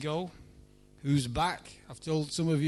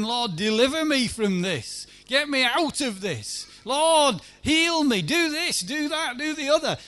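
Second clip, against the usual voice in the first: rising to a loud cry, "Lord, heal me"; desperate pleading prayer; 7 semitones higher, 5 dB louder than usual. Retold or imitated. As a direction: beg God louder and louder, then shouting.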